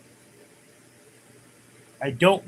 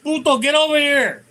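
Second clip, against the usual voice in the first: much higher, louder call across the house; 12 semitones higher, 4 dB louder than usual. Retold or imitated. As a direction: yell for the kid like his mom. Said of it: "she called him Puto".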